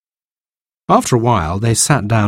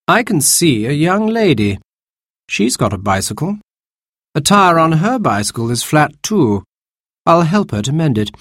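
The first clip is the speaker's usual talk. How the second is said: put-on young man's voice; 7 semitones higher than usual. Baby spots a young lady.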